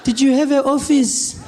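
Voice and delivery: high, drawn-out grandmother impression; doubting granny voice. Grandmother disputing that he had a job, high and slow. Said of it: "She's like".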